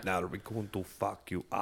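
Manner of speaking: put-on slow, menacing Russian accent; mock Russian accent. Voicing Russia as the menacing kid.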